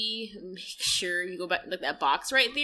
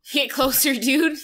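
louder, pushing call; loud urging; 5 semitones higher, 10 dB louder, slower than usual. Real, not acted. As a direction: egg them on loudly. Urging player in game.

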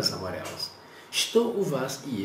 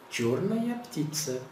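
slow model phrase; 3 semitones higher, much slower than usual. Shown as a demonstration.

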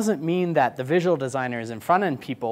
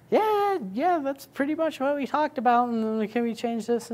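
much higher, sing-song client voice; mock client; 11 semitones higher than usual. Client approving then asking for changes, high mock voice. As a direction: play the agreeable client who then asks for changes.

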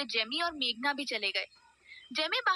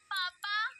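calling out Papa; 8 semitones higher, 3 dB quieter, much slower than usual. A narrator's reading. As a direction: sing out पापा on a high rising call. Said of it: "पाखी चिल्लाते हुए बोली".

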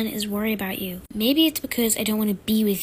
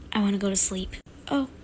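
normal talking voice

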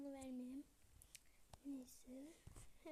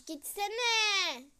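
higher, much louder shout; angry yell; 5 semitones higher, 15 dB louder than usual. Real, not acted. To her brother barging into the room, furious yell. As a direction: scream at him to get out.

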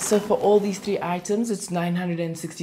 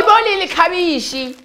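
high, loud call across store; 10 semitones higher, 9 dB louder than usual. Acted. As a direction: shout a name across the shop. Shouting to colleague.

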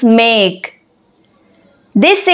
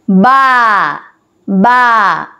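low baa; 5 semitones lower than usual. Shown as a demonstration. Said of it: "What sound does a sheep make?".

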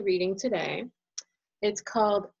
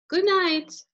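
high, lifted call; calling good night; 9 semitones higher, 4 dB louder than usual. Acted. Mom calls good night to child, high call. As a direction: call it down the hall. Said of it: "I call".

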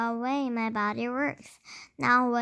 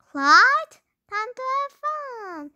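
playful monkey; 11 semitones higher, 7 dB louder than usual. Acted. Monkey Clyde gleefully escaping.

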